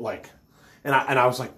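ordinary talk

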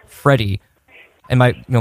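ordinary talk

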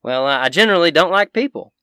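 high, loud country crankiness; cranky Southern shopkeeper. Acted. Shopkeeper fed up with questions, cranky and high. Game master voices shopkeeper.

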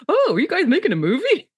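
high-pitched mock innocent curiosity; clueless wonder. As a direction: imitate someone blundering in, amazed. Todd voiced wandering in, clueless.

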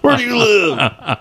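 high-pitched joking interrogation; mock outraged dad. Co-host playing the dad threatening the online stranger, mock outrage. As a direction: play indignant, half laughing.

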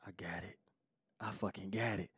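secret whisper, barely voiced; a scheming whisper to himself. Real, not acted. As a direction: hush it so she won't hear. Girlfriend nearby, secret plan.

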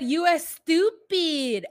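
high, slow, loud exaggerated jibe; hammed-up mock Spanish; mock Spanish accent. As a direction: drag it out like a cruel joke. Quoting Ed's mockery.

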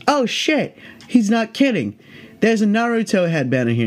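high, startled mock-cop yelp on "Oh shit"; surprised cop. Imitating the second cop finding the headband, high and startled. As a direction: play the cop caught off guard, voice jumping up.

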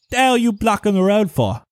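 put-on high-pitched British-accented villager; British-sounding villager. Farmer challenges strangers blocking the road, British-accented and indignant. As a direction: bark it out in a British-style rustic accent. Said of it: "Your accent is, like, British".

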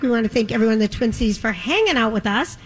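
ordinary delivery

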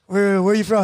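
ordinary talk

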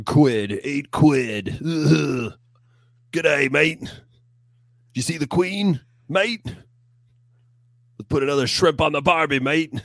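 hammed-up British accent, pitched high and loud; badly faked Brit, straying into Aussie; mock British accent. Host hamming up British slang for a co-host, mock British accent. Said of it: "I'm a British guy".

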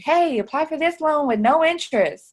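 high, loud mock loan offer; eager lender's pitch. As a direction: pitch it like a pushy credit advertiser. Voices a lender's sales pitch.

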